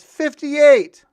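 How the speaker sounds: high, very loud yell; new inmate yelling his joke number. Retold or imitated. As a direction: belt the number across the room.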